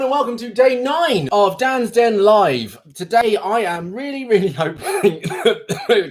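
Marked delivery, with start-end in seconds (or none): 0.0-2.2 excited greeting. Real, not acted. Live stream opening greeting.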